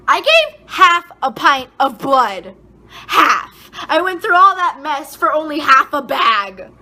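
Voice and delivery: loud, exasperated ranting; angry. Real, not acted. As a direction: hammer each word in outrage. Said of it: "I'm so angry".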